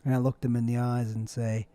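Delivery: normal talking voice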